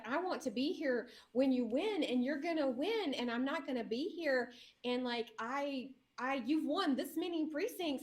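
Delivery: high, insistent imitation of a frantic friend; retold hysterics. Friend who must leave before the result, mock hysterical. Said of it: "she was hysterical".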